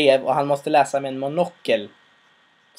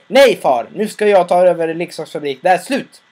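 louder, higher resolute refusal; firm defiance; 4 semitones higher, 7 dB louder than usual. Retold or imitated. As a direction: declare you are done with him. Son declares independence to father.

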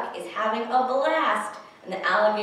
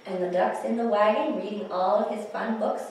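ordinary delivery

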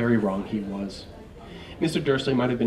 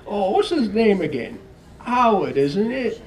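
high, louder faking forgetfulness; feigned vagueness; 10 semitones higher, 6 dB louder, slower than usual. Acted. Mister Dursley feigning to forget the boy's name, high. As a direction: pretend you can't recall.

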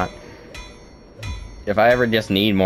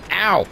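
sharp ow; 9 semitones higher than usual. Real, not acted. Hit by enemy.

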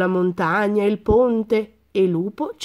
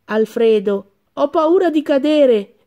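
high, louder frightened cry; terrified; 6 semitones higher, 5 dB louder than usual. A narrator's reading. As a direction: cry out scared from the treetop. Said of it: "era terrorizzato". (grido acuto e spaventato dall'albero)

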